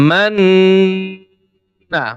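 মান্না with a long humming ন before the final vowel; long nasal ghunna. Shown as a demonstration. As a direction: stretch the nasal hum in মান্না slowly. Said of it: "আমি গুন্নাটা শব্দ করছি".

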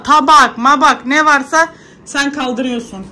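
high, loud order to the daughter; scolding. Real, not acted. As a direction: tell her off, up and loud. Mother ordering daughter to tidy. (kızına yüksek sesle toparla emri)

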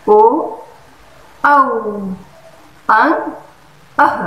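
"ओ, औ, अं, अह" each said alone; separate, emphatic letter sounds for a child; vowel sound demo. Shown as a demonstration. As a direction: recite each vowel loudly with long pauses. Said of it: "आप उसे इस तरह से सिखाएं".

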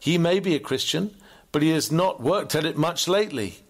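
high, emphatic schoolboy reply; lightly voiced boy, pitch swooping up; American boy. Boy admitting his father's slack faith, high-pitched. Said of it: "The boy answered".

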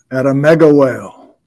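announcer reveal. Acted. Host showing viewers the bakery sculpture, announcer reveal. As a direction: present it like a TV anchor, punching mega.

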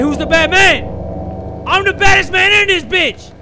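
high, loud mock bravado; defiant yell. Real, not acted. Streamer shouting at the unseen bad man, high defiant yell. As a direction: shout your challenge to the dark.

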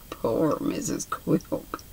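soft whispered aside, slow; pitying whisper. A narrator's reading. The narrator pitying Quilp's wife, hushed whisper. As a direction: breathe it with pity.

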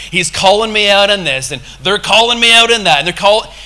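loud, mounting mock complaint; mock offended. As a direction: protest it, imitating someone feeling picked on.

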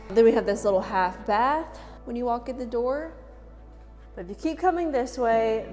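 ordinary talk, nothing marked

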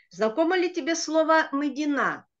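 usual speaking voice

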